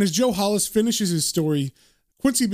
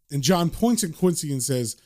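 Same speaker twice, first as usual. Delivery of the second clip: plain reading voice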